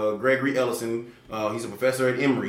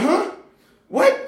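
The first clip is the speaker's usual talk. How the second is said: very high, loud startled squawk; shocked huh; 6 dB louder than usual. Retold or imitated. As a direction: jolt up in disbelief. Bank asks for social security number.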